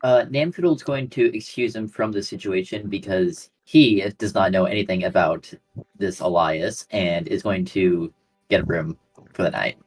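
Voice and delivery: normal talking voice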